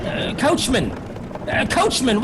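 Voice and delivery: nervous shout up to the box; calling out. Acted. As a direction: raise the voice to reach the driver. Frightened passenger calls the driver.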